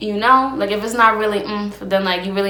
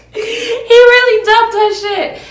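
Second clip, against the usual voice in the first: laughing hard; 14 semitones higher, 7 dB louder, much slower than usual. Real, not acted. Cracking up at the story.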